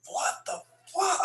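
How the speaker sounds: voiceless, under-the-breath retold curse; hushed disbelief. Skater told to go do it, hushed disbelief. Retelling his angry disbelief.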